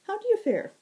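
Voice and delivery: high, ringing, lilting fae voice; bright high herald. Acted. Fae herald greeting the wounded Zack, high and bright. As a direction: sing it out high and sweet.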